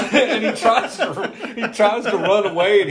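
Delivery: high-pitched, breaking up with laughter; laughing while talking. Real, not acted. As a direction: tell it through real giggles. Cracking up after his own impression.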